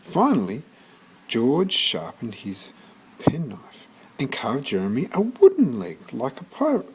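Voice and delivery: usual speaking voice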